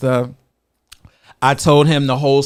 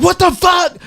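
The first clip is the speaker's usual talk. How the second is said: startled. Retold or imitated. Startled husband's reaction retold.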